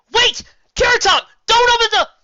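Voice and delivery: very high, very loud yell; urgent warning shout. Acted. Weather mare screams at friend not to open shed. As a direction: scream a warning before it's too late. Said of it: "The warning came too late".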